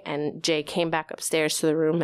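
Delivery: ordinary talk, nothing marked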